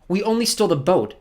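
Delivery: put-on high, forceful girl's voice; defiant girl. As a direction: accuse the villain, pitched high. Girl protests to villain.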